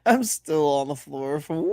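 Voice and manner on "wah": imitated high cartoon wail; mock falling-character yelp; Mario wail. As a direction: mimic a video game plumber's cry. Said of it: "Fucking Mario sounds for fucking Patino".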